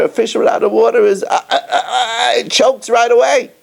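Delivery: mock choking gasps; choking fish gasps.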